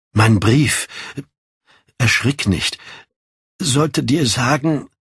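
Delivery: halting, with long pauses; hesitant news-breaking. Acted. Lord Henry prepares Dorian for bad news, hesitant.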